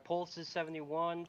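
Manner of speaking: usual speaking voice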